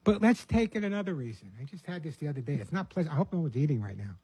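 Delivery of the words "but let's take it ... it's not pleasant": ordinary delivery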